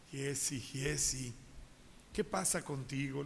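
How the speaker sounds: low, soft, slow reassurance; calm prophet. Acted. Eliseo calms his frightened servant, soft and slow. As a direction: settle him down gently, slowly.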